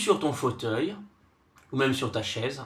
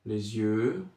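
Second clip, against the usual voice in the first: soft, low meditation voice with long silences; guided body scan; 5 semitones lower, 5 dB quieter than usual. Acted. Sophrology guide takes listener from forehead to chest, soothing guided relaxation.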